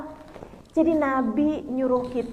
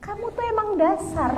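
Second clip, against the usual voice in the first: higher-pitched, playful telling-off; mock scolding; 7 semitones higher than usual. Wife answers her husband's apology, mock-indignant scolding. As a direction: act a wife turning on him, voice lifted.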